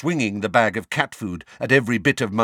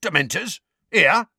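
high, startled questioning; aghast; 11 semitones higher, 5 dB louder than usual. A narrator's reading. Mundungus hearing Dementors are nearby, aghast. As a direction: rise to a loud, alarmed question.

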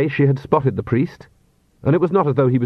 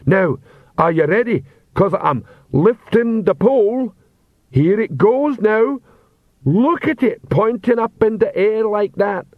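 proud ceremonial troll; 9 semitones higher, slower than usual. A narrator's reading. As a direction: make a grand show of nothing. Troll lifts imaginary pole.

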